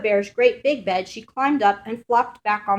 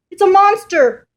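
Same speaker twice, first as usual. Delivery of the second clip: moaned; 10 semitones higher, 10 dB louder than usual. Acted. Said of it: "moaned Mama Bear".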